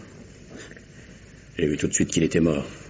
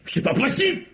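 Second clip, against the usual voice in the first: shocked disbelief. Acted. Shocked denial of girlfriend's guilt.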